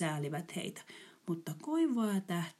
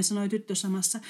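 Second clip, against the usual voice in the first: usual speaking voice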